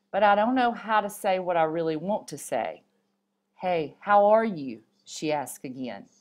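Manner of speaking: usual speaking voice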